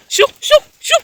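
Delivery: very high, loud shoo; shooing shout. Real, not acted. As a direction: shoo the dogs away sharply. Chasing dogs off the greens.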